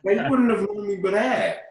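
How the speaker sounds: high, loud and incredulous; really can't believe it; shocked disbelief. Real, not acted. Disbelief at demolition.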